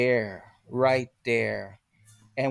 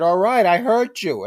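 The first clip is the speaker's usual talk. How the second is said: defensive reply; 7 semitones higher, 5 dB louder than usual. Retold or imitated. Answering the angry boss.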